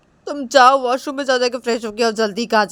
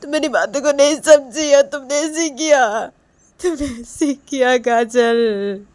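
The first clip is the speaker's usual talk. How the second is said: acted tearful reproach; 6 semitones higher, 14 dB louder than usual. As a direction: reproach her as if weeping. Acted Reshma tearfully reproaching Kajal.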